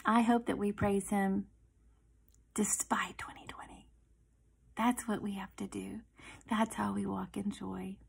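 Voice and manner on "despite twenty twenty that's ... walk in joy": soft, hushed encouragement; whispered close. Real, not acted. Encouraging the ladies at the close, soft whisper. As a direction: whisper it like a gentle promise.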